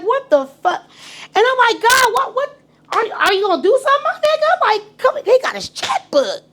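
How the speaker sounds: high, excited disbelief; retelling her shock that he'd pay; incredulous high squeal. Retold or imitated.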